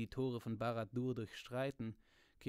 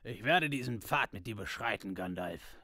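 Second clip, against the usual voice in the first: louder, changed dwarf voice; dwarf pledging himself to Gandalf, louder; Gimli's voice; 7 dB louder than usual. A narrator's reading. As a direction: redo it as the gruff-sounding dwarf, louder. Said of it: "Nein, das ist ein".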